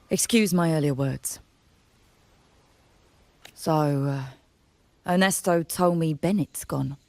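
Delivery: ordinary talk, nothing marked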